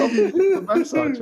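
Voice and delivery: high, thin self-pitying whine; pathetic voice. Retold or imitated. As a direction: whimper it out pathetically. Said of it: "In the most pathetic voice".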